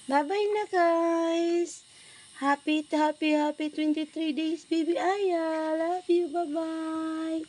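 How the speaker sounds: high, drawn-out cooing farewell. Real, not acted. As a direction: coo the goodbye high, stretching phrase ends. Sing-song sign-off for baby.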